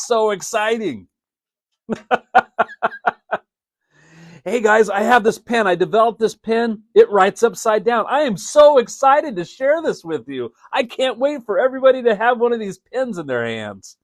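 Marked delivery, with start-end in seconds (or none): 4.4-14.0 much higher and louder, bubbling with excitement; eager inventor gushing about his new pen; excited. Shown as a demonstration. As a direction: sell the upside-down pen like you can't contain yourself. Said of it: "That's a little more exciting".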